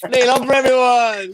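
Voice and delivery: high-pitched MC shout-out; rally the crowd to clap; rousing applause call. Real, not acted. Host to audience after a comedian's set, rousing call for applause.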